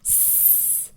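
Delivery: स as a long voiceless "sss"; soft sustained hiss on स; pure S sound. Shown as a demonstration. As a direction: hiss स with no vowel. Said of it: "एस का sound होता है".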